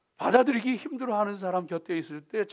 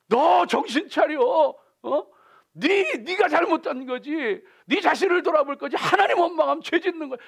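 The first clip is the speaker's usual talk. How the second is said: scolding rebuke; 10 semitones higher, 8 dB louder than usual. Retold or imitated. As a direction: scold them to come to their senses. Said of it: "막 이럴 때". (분노한 사람을 높고 크게 다그치며 꾸짖음)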